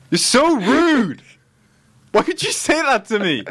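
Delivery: high, drawn-out wail of complaint; hammed-up hurt protest; hurt whine. Real, not acted. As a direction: whine it up high, wounded by teasing. Mock upset at teasing.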